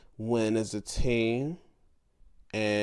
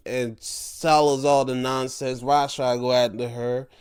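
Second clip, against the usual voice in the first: ordinary talk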